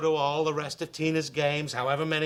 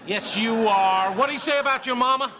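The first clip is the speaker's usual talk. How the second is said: taunting; 6 semitones higher, 6 dB louder than usual. Acted. Egging on the fight.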